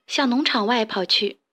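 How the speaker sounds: plain reading voice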